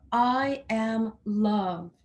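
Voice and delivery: slow, loud, falling like a command; I am loved said as a firm command; firm statement. Shown as a demonstration. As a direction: declare it, no question in it. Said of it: "one that's just creating a command".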